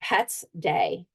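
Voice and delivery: ordinary delivery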